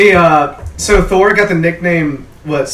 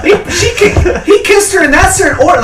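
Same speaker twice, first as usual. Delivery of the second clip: giggling through words; laughing while talking; 13 semitones higher, 4 dB louder than usual. Real, not acted. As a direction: talk through high laughter.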